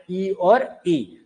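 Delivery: ई और ई held very long and slow; drawn-out vowels. Shown as a demonstration. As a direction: stretch each ई slowly for the class. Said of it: "कौन कौन से स्वर है हमारे". (ई और ई को बहुत धीरे खींचकर)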